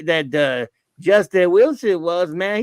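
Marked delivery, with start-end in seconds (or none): none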